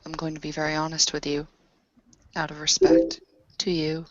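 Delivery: ordinary talk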